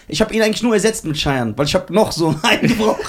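building hype, big finish on Farid; grand entrance announcement. Pretend. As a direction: announce the star like a showman. Host announces guest's entrance.

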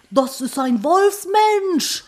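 hoch und langsam, gespielt panisch; panischer Spaziergänger. Retold or imitated. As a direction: aufgeregter Ruf, übertrieben gedehnt. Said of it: "Leute, die im Wald spazieren gehen". (aufgeregt gespielter Ruf)

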